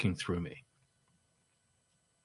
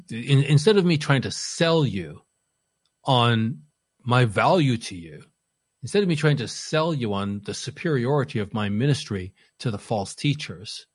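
ordinary talk, nothing marked

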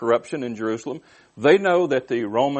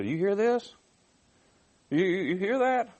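high, incredulous questioning; aghast question rising at the end; indignant priests; 8 semitones higher than usual. Acted. Indignant priests voiced by preacher.

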